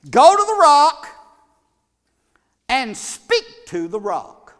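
booming high, loud command, slowing at the end; preacher voicing God's loud command. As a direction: thunder it down like the voice of God. God commands Moses in sermon.

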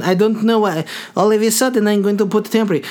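high, hammed-up Russian voice; exaggerated Russian accent. Mocking own slip in dentist voice, hammed-up Russian accent. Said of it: "I don't know why I went Russian".

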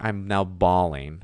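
usual speaking voice

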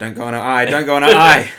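loud pleading outburst, building; alarmed plea. Retold or imitated. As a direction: shout it at the screen in alarm. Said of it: "And then you were like".

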